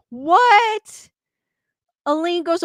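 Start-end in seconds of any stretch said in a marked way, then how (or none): 0.1-1.1 higher, louder startled "What?"; surprised. Real, not acted. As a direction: react in real shock. Startled by Alexa recording story.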